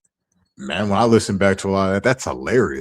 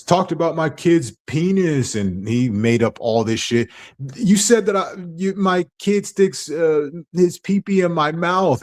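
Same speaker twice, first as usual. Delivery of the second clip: much higher whiny mock voice; mocking imitation of Kevin; 10 semitones higher than usual. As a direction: lampoon his complaint in a high, aggrieved tone. Mocking quote of harasser.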